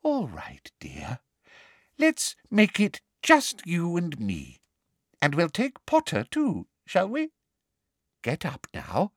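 teacher faking tenderness to a pupil; motherly. Acted. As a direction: coo it like a false mother. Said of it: "what she clearly thought was a motherly voice".

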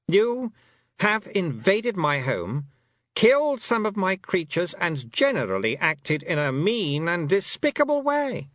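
high, slow, brittle offence; affronted fairy queen. A narrator's reading. A fairy queen affronted by a rude girl. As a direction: act scandalised, icy sweet.

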